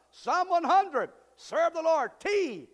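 preaching at full force. Acted. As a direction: shout the reference to the hall. Preacher proclaiming.

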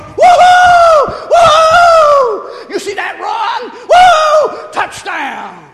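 high, shouted ball game whoops; hammed-up sports crowd yell; imitated fan cheering. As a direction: whoop wildly like a fan in the stands. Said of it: "there was some".

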